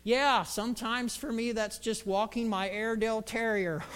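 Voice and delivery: raspy mentor voice; Lyle. Acted. Mentor admitting dog-walking is a commitment, raspy. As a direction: rasp out a sly confession. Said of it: "had this kind of raspy voice".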